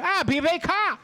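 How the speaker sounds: mock caller. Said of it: "imitating the caller".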